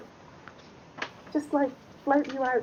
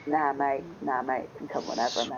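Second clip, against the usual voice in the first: lower Australian bloke; 7 semitones lower than usual. Pretend. Player leans into Aussie bloke.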